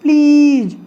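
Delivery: Please with a loud, held 'ee'; stress on long ई. Shown as a demonstration. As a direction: push and stretch the vowel of please. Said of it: "जोर नहीं पड़ रहा है ई पर". (Please की ई पर ज़ोर)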